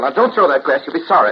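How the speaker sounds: alarmed warning. Acted. Stopping Bill throwing that glass, alarmed shouted warning. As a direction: loudly warn them off, voice jumping up.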